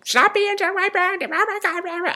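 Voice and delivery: high, shrill-pitched mock scream at the kids; mock yelling mother. Mother in grocery store screaming at her kids, mock yell. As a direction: imitate a frazzled mom yelling, way up high. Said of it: "she's yelling at them".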